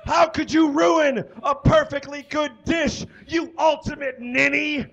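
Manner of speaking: high, loud furious shout; violent yell. Acted. Gordon screaming at Paula over the ruined dish, high furious yell. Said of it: "he yelled violently".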